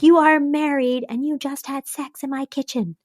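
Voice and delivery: high, pointed accusation; accusing. Retold or imitated. Carrie accusing Miranda, high and pointed. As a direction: spell out the accusation. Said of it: "And she's like".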